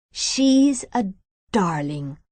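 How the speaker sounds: slow, lightly Irish lilt on the line; a gentle hint of an Irish accent. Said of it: "says Ted in his Irish voice".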